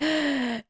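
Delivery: high, sharp mock gasp; exaggerated intake of breath. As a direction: gasp in pretend shock. Acting out the viewers' reaction.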